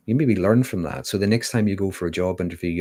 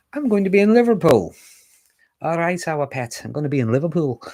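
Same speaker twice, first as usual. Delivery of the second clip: much higher, louder hammed-up Liverpool accent; terrible Liverpool accent; 9 semitones higher, 5 dB louder than usual. Shown as a demonstration. Announcing her Liverpool talk in a mock Liverpool accent. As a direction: play up a bad Scouse accent, calling everyone pet. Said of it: "a terrible impersonation of a Liverpool accent".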